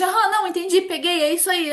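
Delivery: high, eager rush of agreement; eager quick agreement. Retold or imitated. Imitating the eager warm person cutting in. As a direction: play the impatient talker nodding along.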